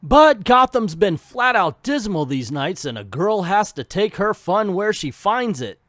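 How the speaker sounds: lightly voiced Ivy talking to her horse; Ivy's voice. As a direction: tease like a wry Ivy. Ivy voiced reading comic.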